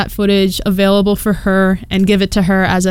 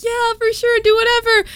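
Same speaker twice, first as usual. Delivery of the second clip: breezily agree, pitched way up; high agreeable reply; 14 semitones higher than usual. Retold or imitated. Vlogger agreeing to drunk strangers, high easygoing voice.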